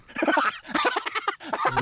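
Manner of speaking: very high mock cackle; squealing laugh of someone tripping; imitated helpless laughter. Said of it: "I'm, like, dying".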